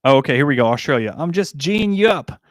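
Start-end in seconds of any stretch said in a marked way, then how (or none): 1.2-2.4 put-on slow, higher Australian accent; Australian slang. Australian accent, teasing the chat about local slang. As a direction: lean into the Aussie twang, teasing. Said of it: "Which just means I'm winding you up".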